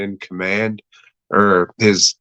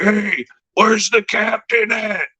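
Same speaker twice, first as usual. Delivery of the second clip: much higher, put-on soldier calling out; mock soldier voice; 12 semitones higher than usual. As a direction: squeak the question like a cartoon soldier. Soldier quote in war retelling.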